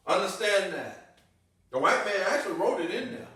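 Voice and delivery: loud, driving pulpit voice; a loud hammering sermon cadence; preaching at full force. Acted. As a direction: preach it hard to the congregation.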